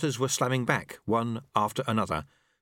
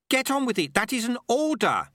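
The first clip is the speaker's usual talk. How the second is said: high, forceful command; urgent order; 12 semitones higher, 3 dB louder than usual. Acted. Sweeper ordering apprentice to get on with it, urgent order.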